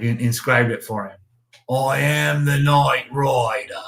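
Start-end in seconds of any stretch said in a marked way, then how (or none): 1.7-3.8 very slow, hammed-up Aussie proclamation; mock Australian, every vowel drawn out; terrible Australian accent. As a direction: declare it in an exaggerated Australian accent. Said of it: "Australian accent".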